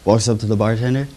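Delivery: ordinary delivery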